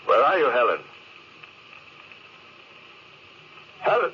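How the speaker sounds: stranded captain calling for his lost wife. Acted. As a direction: call into the dark, searching.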